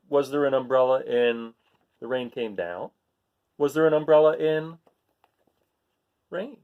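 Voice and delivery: ordinary delivery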